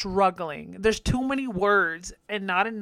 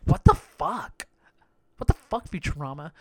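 baffled; 3 semitones lower, 3 dB louder than usual. Retold or imitated. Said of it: "you're just like".

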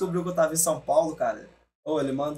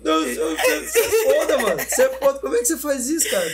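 high, loud rant that cracks; cracking voice; 10 semitones higher, 6 dB louder than usual. Retold or imitated. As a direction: yell until the voice breaks. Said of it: "dá uma arranhada na voz".

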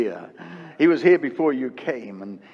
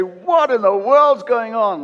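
slow, raised, louder worried question; higher, slow and louder in mock alarm; mock bewilderment; 6 semitones higher, 6 dB louder, slower than usual. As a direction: play the alarmed listener. Imitating a worried listener.

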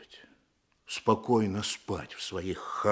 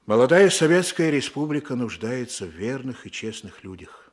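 solemn declaration; 8 dB louder than usual. Acted. Chief's solemn appeal.

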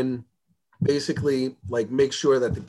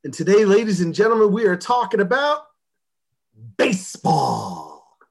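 announcer voice; 4 semitones higher than usual. Acted. Host announces topic.

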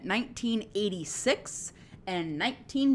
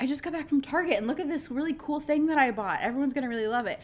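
quick, higher chirpy imitation; imitated video girl; 4 semitones higher, much faster than usual. Girl showing off her Target find, quick imitation. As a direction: rattle it off like an excited shopper. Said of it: "this girl was like".

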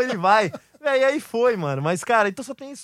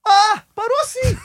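a high, loud, slowed startled "ah"; stunned exclamation; 9 semitones higher, 4 dB louder, much slower than usual. Retold or imitated. Retelling the caller's stunned reaction, high and loud. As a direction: ham up the shocked pause of the caller. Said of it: "Aí ele".

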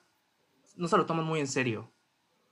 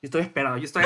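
normal talking voice

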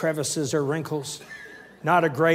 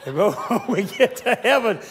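higher, helpless laughing delivery; laughing while talking; 5 semitones higher, 3 dB louder than usual. Real, not acted. Husband joking about heaven to wife, laughing. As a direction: push the words through laughter. Cracking up at his own joke.

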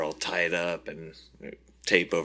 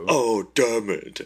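slow, louder hammed-up groan of defeat; mock villain caught; 5 semitones higher, 5 dB louder, slower than usual. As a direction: play the busted villain for laughs. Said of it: "That was pretty good".

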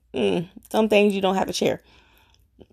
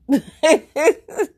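high burst of laughter; 7 semitones higher, 5 dB louder than usual. Real, not acted. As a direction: laugh at your own story. Laughing while telling family story.

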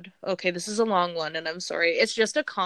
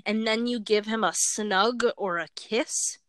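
normal talking voice

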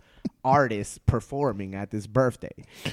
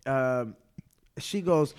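normal talking voice

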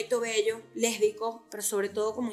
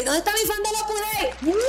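calling out; 9 semitones higher than usual. Real, not acted. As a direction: shout it out to the crowd. Calling out to her fans.

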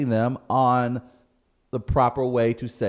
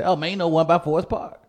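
higher, louder St. Louis local voice; St. Louis accent; 8 semitones higher, 4 dB louder than usual. Retold or imitated. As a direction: say it just like the locals do. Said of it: "we say it just like that".

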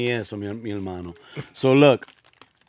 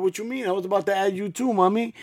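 put-on high, quick, innocent kid voice; innocent son; 6 semitones higher, faster than usual. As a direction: play the clueless son, high and fast. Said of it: "He was like".

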